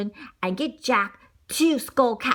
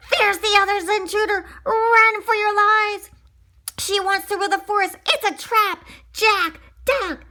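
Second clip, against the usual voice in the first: high, loud panicked squawking; panicked alarm; 11 semitones higher, 5 dB louder than usual. Acted. Duck warning everyone of the human, shrieking in panic. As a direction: raise the alarm like a terrified duck.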